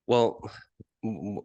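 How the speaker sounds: ordinary talk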